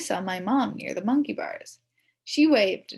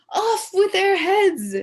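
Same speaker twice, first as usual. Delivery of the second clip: loud, slow mock-king proclamation; shouted royal decree; 10 semitones higher, 9 dB louder, slower than usual. A narrator's reading. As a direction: bellow it like a hammy king. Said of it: "he shouted".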